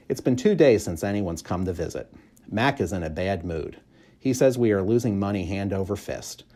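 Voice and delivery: plain reading voice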